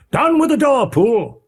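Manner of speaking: high and loud command to break in; shouted order. Acted. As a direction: yell at the butler to smash it. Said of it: "cried Utterson".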